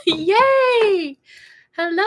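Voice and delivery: high, loud squeal of joy; excited cheer. Real, not acted. Streamer cheering a viewer who joins, high and loud. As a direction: cheer with delight.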